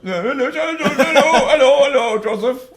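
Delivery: high, loud, laughing hello to the dog; cheerfully greeting a dog who is dead; mock excited greeting.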